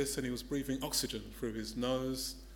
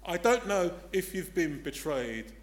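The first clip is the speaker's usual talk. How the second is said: ordinary delivery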